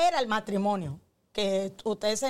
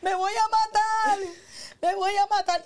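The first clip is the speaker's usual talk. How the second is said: dramatic wail; 9 semitones higher, 3 dB louder than usual. Retold or imitated. Wife sobbing in bed to provoke husband, hammed-up wail. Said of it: "empecé".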